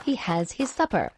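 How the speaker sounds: ordinary delivery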